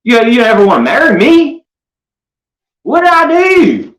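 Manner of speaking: high loud whining voice; mocking Andrew. Mocking Andrew overhearing Ace, high offended whine. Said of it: "He said".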